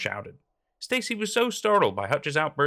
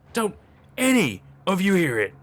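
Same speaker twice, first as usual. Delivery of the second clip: louder, high pleading question; frightened man begging his friends; desperate plea; 7 semitones higher, 7 dB louder than usual. Acted. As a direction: demand they listen, frightened.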